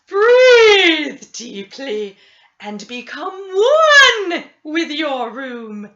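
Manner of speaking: loud, slow, drawn-out therapist voice; hammed-up new-age therapist, loud and slow. A narrator's reading. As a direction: boom it out like a mystic healer.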